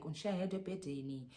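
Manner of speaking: soft, quick mock mumble; imitated mumbling. Boyfriend waking up complains to her, mumbling. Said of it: "he starts mumbling thing about me being hostile".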